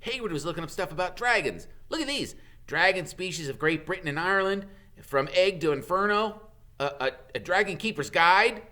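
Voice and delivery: a hint of an excited boy; boy showing friends the dragon books; lightly voiced Ron. A narrator's reading. Ron's voice, higher.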